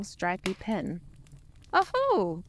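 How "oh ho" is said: high, loud jolly oh-ho; jolly Santa. A narrator's reading. Santa welcoming the bear, jolly chuckle. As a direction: boom out a cheery Santa greeting. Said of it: "said Santa".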